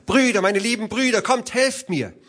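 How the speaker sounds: high, quick, urgent call; acted high-pitched cry for rescue; crying out for help. A narrator's reading. Wife calls to her brothers for rescue, urgent cry. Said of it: "Da schrie sie noch einmal".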